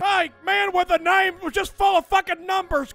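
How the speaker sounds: ordinary talk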